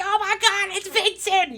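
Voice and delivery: put-on high, loud squeal of excited children; excited kids. Daycare kids spot their visitor Vincent, excited squeal. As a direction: shriek it like a thrilled little kid. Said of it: "all the kids just look at you".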